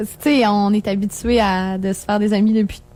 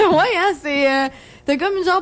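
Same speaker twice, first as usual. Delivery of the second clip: giggling through the words; laughing; 7 semitones higher than usual. Real, not acted. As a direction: laugh it out, amused. Laughing at the sultry voice joke. (en riant)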